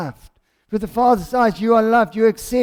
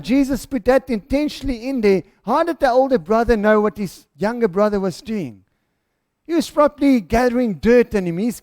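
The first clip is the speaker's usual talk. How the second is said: ordinary delivery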